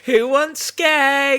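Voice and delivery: hammed-up dodgy dealer pitch; mock dealer hawking. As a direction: call it out like a shady street dealer.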